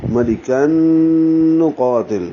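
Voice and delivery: very slow ملکن قاتل, long hummed nasal n; merging with ghunna. Shown as a demonstration. Teacher showing idgham, ملکن قاتل with long nasal hum. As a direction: merge ملکن into قاتل on a drawn-out n. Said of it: "یہ ادغام مال غنہ ہے".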